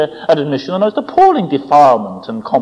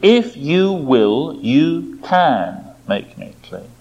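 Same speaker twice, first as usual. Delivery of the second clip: humble confidence; much slower than usual. Retold or imitated. Sick man appeals to Jesus, slow and humbly confident. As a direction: spell out your trust slowly, softening at the end. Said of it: "confidence and humility".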